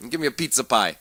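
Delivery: mock New York accent. Radio host riffing on his bad accent, in mock New York accent. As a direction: toss it off like a stage New Yorker. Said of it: "it's more New York".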